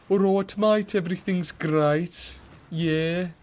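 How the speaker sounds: high, slow Birmingham accent, hammed up; a cheerful Peaky Blinders Brummie, pitched well up; Brummie impression. Streamer tries a Birmingham accent for chat, hammed-up Brummie. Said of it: "That's probably the worst impression".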